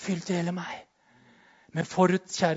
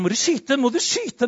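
anxious urging; 7 semitones higher, 4 dB louder than usual. Retold or imitated. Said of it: "jeg begynner å svette".

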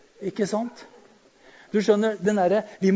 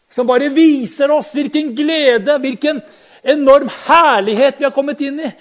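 loud, higher revival preaching; full-force preaching; 7 semitones higher, 8 dB louder than usual. Acted. Preacher rebuking Christians who cling to the old covenant, full-force preaching. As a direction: preach it out with fire.

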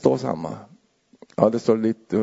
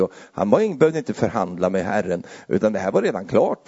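ordinary delivery

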